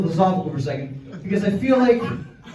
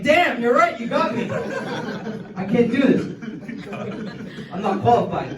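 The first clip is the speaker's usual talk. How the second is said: higher, half-laughing give-up; laughing mock defeat; 5 semitones higher than usual. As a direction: concede it with a laugh. Speaker acts caught out.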